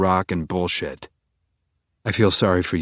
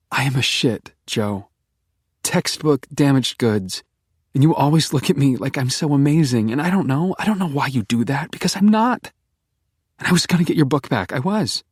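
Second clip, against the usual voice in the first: put-on higher, pleading young woman, self-loathing; Beck's voice; 6 semitones higher than usual. A narrator's reading. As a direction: rush it out, breaking with guilt. Beck voiced by narrator.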